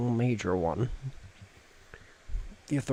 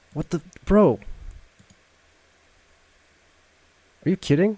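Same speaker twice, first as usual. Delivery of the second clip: shocked; 8 semitones higher, 7 dB louder than usual. Real, not acted. Shocked at game twist.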